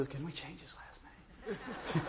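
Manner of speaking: hushed parents' whisper; mock conspiratorial whisper; whispered aside. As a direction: whisper it like a secret plot.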